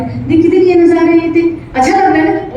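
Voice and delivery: high, loud, eager pointing-out voice; mother thrilled for her little boy; excited mother. Acted. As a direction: show the child the view, delighted. Mother showing child the sights.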